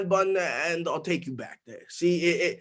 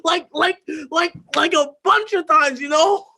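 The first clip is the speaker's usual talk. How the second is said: higher, louder and faster, near hysteria; teen confessing the beating, frantic shout; frantic retelling; 10 semitones higher, 5 dB louder than usual. Acted. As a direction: spill it out, spiralling out of control. Retelling beating, rising frenzy.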